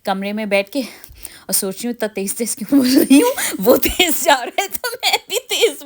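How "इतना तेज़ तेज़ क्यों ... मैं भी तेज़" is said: loud, high-pitched laughing talk; amused outburst through laughter; laughing at herself. Real, not acted. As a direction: crack up while talking, voice shooting up. Laughs at own fast talking.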